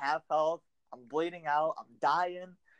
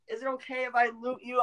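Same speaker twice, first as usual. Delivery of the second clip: higher, sheepish little question; sheepish request; 10 semitones higher than usual. Retold or imitated. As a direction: ask timidly, a bit higher. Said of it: "then you're like".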